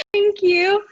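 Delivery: high, loud, emotional thanks; overcome with joy. Real, not acted. Winner thanking presenter for award, overcome with joy. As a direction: squeak out thanks, close to tears.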